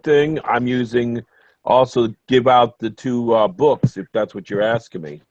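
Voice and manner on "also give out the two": ordinary talk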